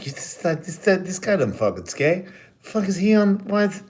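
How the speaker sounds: imitating his own outrage at announcers who don't skate; mock outraged rant. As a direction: squeal it like a disgusted skater ranting at announcers. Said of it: "announcers that I'm like".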